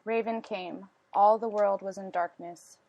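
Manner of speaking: ordinary delivery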